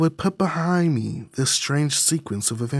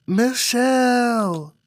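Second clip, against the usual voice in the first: calling out; 9 semitones higher, 4 dB louder, much slower than usual. Acted. Name called out again.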